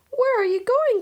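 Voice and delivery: high, loud alarmed cry. A narrator's reading. Mother calling after the rolling wok, loud alarmed cry. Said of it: "cried Mama Zhang".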